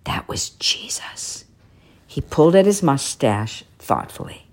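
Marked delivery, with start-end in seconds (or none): 0.0-1.4 slow awed whisper; to himself. A narrator's reading. Shoemaker to himself after the dream, slow whisper. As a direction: barely breathe the name. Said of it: "said the old man to himself".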